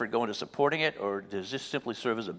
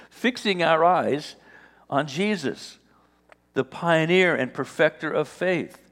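louder, building scripture proclamation; scripture reading; 3 semitones higher, 7 dB louder than usual. Acted. Preacher reading Hebrews to the congregation, building proclamation. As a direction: proclaim the verse with mounting force. Reads scripture with rising force.

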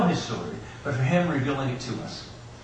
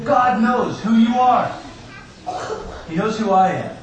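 loud preaching; 8 semitones higher, 5 dB louder than usual. Real, not acted. Preacher builds to the point.

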